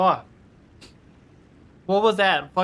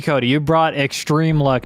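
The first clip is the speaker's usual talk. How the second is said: normal talking voice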